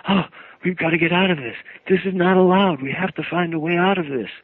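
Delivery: high, loud panicky creature voice; frightened creature falling apart; shaking creature. Retold or imitated. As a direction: babble like a terrified imp. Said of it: "He started shaking".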